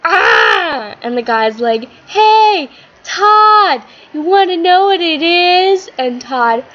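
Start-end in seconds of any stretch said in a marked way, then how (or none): 0.0-1.0 startled cry. Acted. Todd startled by the guy. 2.1-5.9 high, loud call across the street. Acted. The guy calling Todd across the street, loud and high.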